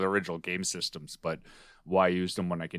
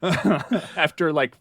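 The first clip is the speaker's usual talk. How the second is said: laughing out loud, higher; 8 semitones higher, 6 dB louder, much slower than usual. Real, not acted. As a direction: laugh through the word. Laughing at own cheating.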